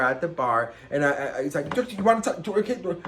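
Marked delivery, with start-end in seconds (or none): 1.7-3.0 high, hyper jumble of words; wired babbling. Retold or imitated. Imitating own frantic self at the bar, wired babbling. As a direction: babble as if on something. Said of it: "you would've thought I was on something".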